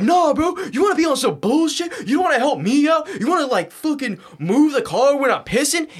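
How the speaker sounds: high, loud, indignant mock rant; retold screaming. Brother getting in his face, screaming. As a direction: yell the accusations with the pitch way up. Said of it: "He's screaming at the top of his lungs".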